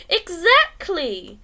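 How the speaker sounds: loud, slow, drawn-out exclamation; emphatic. A narrator's reading. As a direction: land it like a punchline. Emphatic narrator aside.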